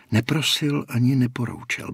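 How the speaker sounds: ordinary delivery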